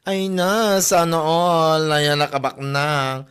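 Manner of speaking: loud, slow sana all; envious exclaim. Acted. Coworker envies the rich colleague, loud slow sana all. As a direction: drag out the envy loudly.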